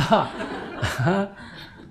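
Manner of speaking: long breathy laugh. Real, not acted. Monk laughs at his own mock lecture to mom, breathy laugh.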